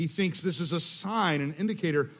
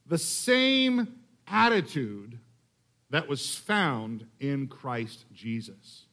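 slow scripture reading; slower than usual. Shown as a demonstration. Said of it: "a little more filled out".

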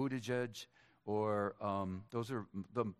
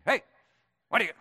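loud, high New Jersey holler; New Jersey accent; 11 semitones higher, 13 dB louder, much faster than usual. Acted. Preacher imitating his pastor from New Jersey, loud accent. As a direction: burst out like a brash New Jersey guy. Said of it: "that's how he talked".

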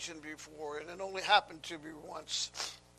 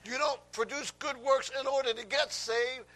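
raised, high, emphatic preacher voice; full-force preaching; 7 semitones higher, 9 dB louder than usual. Acted. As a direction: drive it home to the congregation, loud and high.

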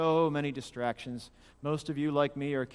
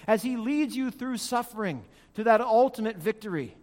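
raised, emphatic pulpit delivery; high, building sermon cadence; impassioned preaching; 5 semitones higher, 4 dB louder than usual. Real, not acted. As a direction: drive each phrase home, climbing in pitch. Preaches at rising intensity.

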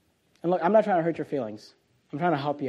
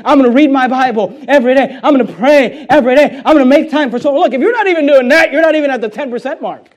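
loud, high-pitched pulpit exhortation; full-force preaching; 6 semitones higher, 10 dB louder than usual. Acted. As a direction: preach it loud, driving each pledge home.

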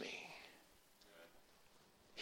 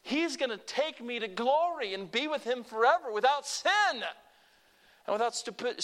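ordinary talk, nothing marked

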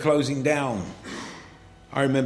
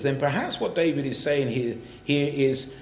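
ordinary delivery

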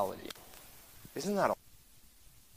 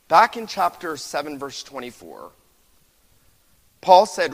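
preaching at full force; 10 dB louder than usual. Acted. Preaching builds again.

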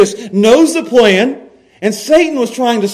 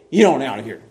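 low dismissal of Satan; 7 semitones lower, 3 dB quieter, faster than usual. Retold or imitated. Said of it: "He looked at him and said".

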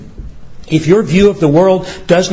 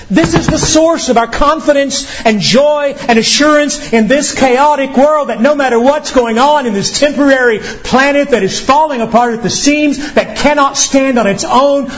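fervent preaching; 7 semitones higher than usual. Acted.

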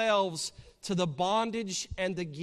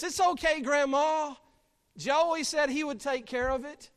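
higher, louder, lightly voiced granddaughter; breezy granddaughter; 8 semitones higher, 5 dB louder than usual. Sally putting Grandma off so she can go fishing, breezy. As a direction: pipe up as a granddaughter dodging chores. Sally dodging chores in the story.

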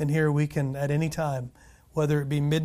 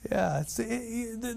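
usual speaking voice

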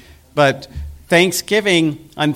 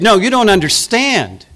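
rising, louder, higher whining complaint; hammed-up self-pitying grumble, higher and louder; mock complaint; 6 semitones higher, 4 dB louder than usual. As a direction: build to an aggrieved high wail. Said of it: "He said".

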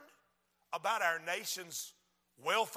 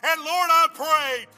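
rhythmic, insistent preacher's pleading; fervent altar prayer; 5 semitones higher, 10 dB louder than usual. Real, not acted. Preacher crying out to God for the nation, fervent prayer. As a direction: plead with God in driving, repeated phrases.

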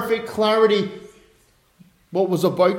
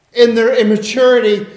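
ordinary talk, nothing marked

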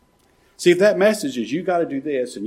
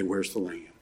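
softer, lower, a hushed plea; quiet prayer; 7 semitones lower, 13 dB quieter than usual. Pretend. Preacher showing a troubled believer praying, hushed and softer. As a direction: breathe the question low to God. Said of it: "Go to the Lord in prayer and say".

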